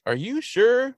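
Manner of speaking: questioning. Retold or imitated. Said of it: "Mulder's like".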